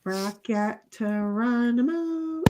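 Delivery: slow, drawn-out Bracket Geronimo; soft slow sounding-out of Bracket Geronimo; said slowly. Shown as a demonstration. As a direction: stretch out Bracket Geronimo slowly to hear a word in it. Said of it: "if you say it slow".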